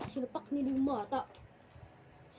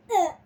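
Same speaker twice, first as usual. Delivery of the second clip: put-on kid's cry; 8 semitones higher, 16 dB louder than usual. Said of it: "Sabi ng bata".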